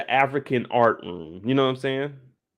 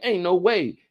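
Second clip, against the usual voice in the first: high, emphatic mock dismay; disbelief; 7 semitones higher, 4 dB louder than usual. Commentator sees Vivian falling for it, mock dismayed. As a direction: refuse to believe it, theatrically. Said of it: "I'm like".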